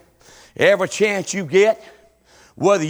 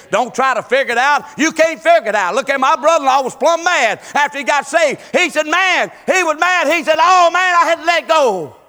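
higher and louder, shouting the key lines; preaching at full force; 8 semitones higher, 5 dB louder than usual. Acted. Preacher urging the congregation to store up praise, fiery preaching. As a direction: drive it home like a revival preacher. Preacher calls people to altar.

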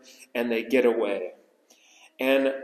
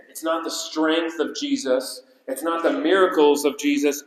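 ordinary talk

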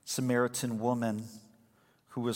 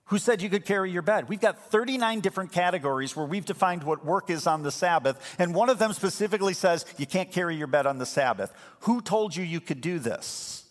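high, loud accusing religious leaders; loud outraged demand from the religious people; indignant Pharisees; 7 semitones higher, 5 dB louder than usual. Retold or imitated. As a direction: scold him like an outraged Pharisee. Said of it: "they're like".

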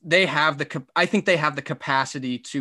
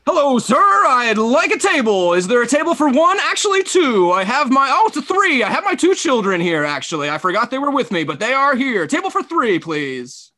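high, loud announcer voice asking for a table; Kevin Harlan ordering; 8 semitones higher, 4 dB louder than usual. Pretend. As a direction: order a table like you're calling a game. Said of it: "you're Kevin Harlan".

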